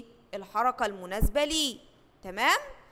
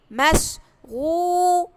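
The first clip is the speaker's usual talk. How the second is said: drawn-out, higher, louder word; stretched out slowly; 5 semitones higher, 9 dB louder, much slower than usual. Shown as a demonstration. Teacher models مشغول for first graders, drawn out slowly. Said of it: "كلمة إيه؟".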